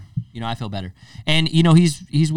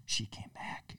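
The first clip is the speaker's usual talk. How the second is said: hushed whisper; 19 dB quieter, slower than usual. Retold or imitated. Spying story, hushed.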